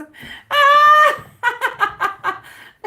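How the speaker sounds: high, loud peal of real laughter. Real, not acted. Reader tickled by tipsy-sounding questions, loud high laughter. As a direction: burst out laughing, high and long.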